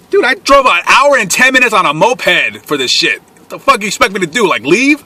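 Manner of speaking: high-pitched, exasperated protest; indignant rant. Acted. Storyteller justifying staying after a long moped ride, indignant rant. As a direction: argue it in high, incredulous outrage.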